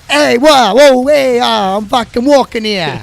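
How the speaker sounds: loud, high mock New Jersey yell; hammed-up New Jersey street shout; bad New Jersey accent. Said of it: "worst New Jersey accent ever".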